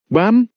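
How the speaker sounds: high, sudden spoken sound effect; sudden "bam"; imitated bang. A narrator's reading. Narrator voices a door bang.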